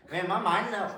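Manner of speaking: laughing through the words; flustered laugh. Real, not acted. Laughs after coughing fit.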